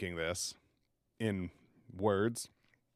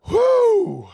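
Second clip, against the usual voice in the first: loud high whoop of pain; 11 dB louder than usual. Real, not acted. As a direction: shout out the burn. Reacting to the burn.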